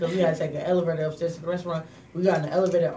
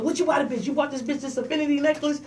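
higher, mock accusing woman; mimicking her demand; 8 semitones higher than usual. Dinner guest grilling about the gift, high mock accusation. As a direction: throw her nosy question back, pitched up.

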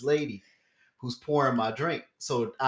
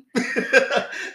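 laughing; 12 semitones higher, 5 dB louder than usual. Real, not acted. Laughs after story beat.

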